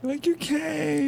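high, drawn-out mock gratitude; hammed-up touched voice; teasing mock gushing. Co-host acts out teasing therapist scene.

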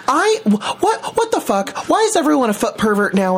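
high, outraged disbelief. Real, not acted. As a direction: squeal it out in disgust. Ranting about feet pictures.

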